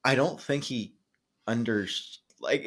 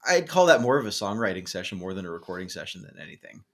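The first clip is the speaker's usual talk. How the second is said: usual speaking voice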